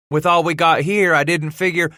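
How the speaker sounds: high, pleading excuse; defensive boy. A narrator's reading. Son excusing himself to father, defensive. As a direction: try to explain before being cut off.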